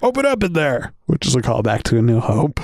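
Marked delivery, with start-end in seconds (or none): 0.0-0.9 high mock trooper demand; lightly voiced trooper; stormtrooper order. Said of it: "the trooper is like".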